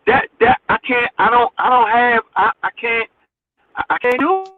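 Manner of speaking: halting, repeated false starts; stammering. Real, not acted. Host holding back what he really wants to say, stammering. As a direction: stumble and restart, holding words back. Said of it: "stuttering and stammering 'cause I ain't gonna say".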